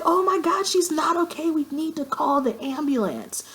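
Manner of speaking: high-pitched mock panic, calling for help; panicked friend. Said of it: "so she's fucking crying".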